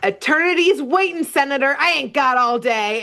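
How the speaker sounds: high-pitched, impatient devil quote; Beelzebub. Retold or imitated. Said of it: "and Beelzebub's like".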